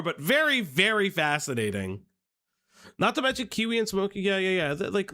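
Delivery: ordinary talk, nothing marked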